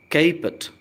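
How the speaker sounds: "caput" said high as "KAY-put"; model pronunciation. Shown as a demonstration. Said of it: "pronunciation पर ध्यान दें". ("caput" को "केपुट" ऊँचे स्वर में)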